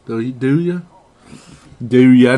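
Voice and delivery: lightly voiced Walken, pausing between; hint of Walken, halting and odd; Christopher Walken impression. Host teasing his co-host with a light Walken bit. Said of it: "We can do Christopher Walken".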